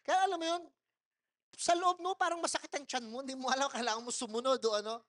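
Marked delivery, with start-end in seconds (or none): none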